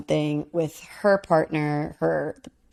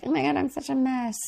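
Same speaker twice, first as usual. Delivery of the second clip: mock flustered woman; 9 semitones higher, 3 dB quieter than usual. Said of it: "'cause we're like".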